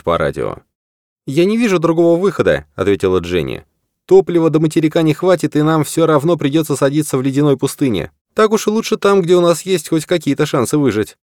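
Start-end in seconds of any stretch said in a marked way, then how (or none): 1.2-2.7 женский голос. Acted. Женский персонаж, голос выше. 4.0-11.2 высокий голос решительной лётчицы; заговорить выше, твёрдо и устало; голос Дженни, выше. Acted. Дженни, женский персонаж.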